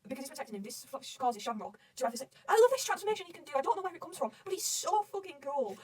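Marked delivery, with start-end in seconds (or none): 2.5-5.8 high-pitched fan gushing; thrilled, high fangirl rave; excited gushing. Real, not acted. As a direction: gush with real admiration, voice pitched up. Fan gushing over a favourite transformation.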